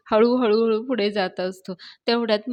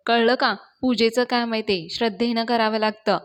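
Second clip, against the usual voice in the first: plain reading voice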